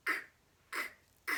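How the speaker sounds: sharp voiceless K bursts; short dry clicks of breath; coughing K. Shown as a demonstration. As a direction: cough out separate K sounds. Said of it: "is the coughing sound".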